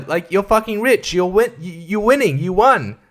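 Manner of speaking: ordinary talk, nothing marked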